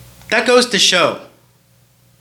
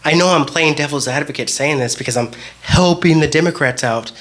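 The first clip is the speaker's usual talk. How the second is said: ordinary talk, nothing marked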